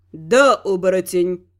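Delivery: low, slow, satisfied god voice; smug low answer; pleased god. Acted. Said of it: "довольно хмыкнул Бог".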